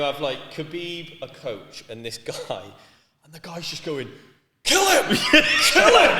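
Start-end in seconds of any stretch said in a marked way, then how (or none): 4.6-6.1 screaming kill him, high and loud; laughing high-pitched yell; mock shout. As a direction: yell it like a frantic cornerman. Cornerman yelling at fighter.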